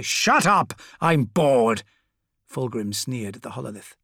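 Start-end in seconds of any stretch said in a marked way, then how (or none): 0.0-1.8 loud bored snap; petulant shout. Acted. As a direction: cut him off, sick of it. Fulgrim cuts Fabius off.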